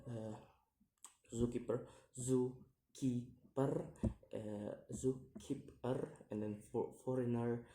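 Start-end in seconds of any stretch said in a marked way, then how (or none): 2.2-3.8 "zookeeper" slowly in three beats; syllable by syllable. Shown as a demonstration. Teacher demonstrating syllables, "zookeeper" slow and split. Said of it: "three syllables to say". 4.9-6.1 "zookeeper" slow, in separate syllables. Shown as a demonstration. Teacher demonstrating syllables, "zookeeper" slowly split. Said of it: "three syllables to say".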